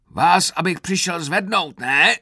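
loud, high-pitched snide jab. A narrator's reading. Sergeant to a recruit still on the grass, snide and loud. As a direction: needle him loudly with a sarcastic question. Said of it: "řekl Krpata jízlivě".

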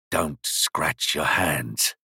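dry, slow, low whisper; raspy whisper. A narrator's reading. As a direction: rasp it out stealthily, barely voiced. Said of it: "His dry whisper came to me".

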